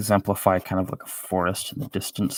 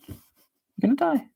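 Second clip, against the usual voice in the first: much higher, squeaky gonna die; mock falsetto doom; faster than usual. Mocking doom after squeaky scold.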